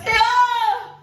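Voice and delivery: loud, high call of "Senhor"; crying out. Retold or imitated. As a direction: cry out to God. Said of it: "Começo a clamar".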